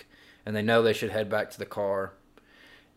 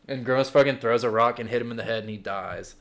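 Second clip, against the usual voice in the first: normal talking voice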